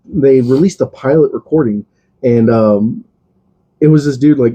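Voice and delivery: usual speaking voice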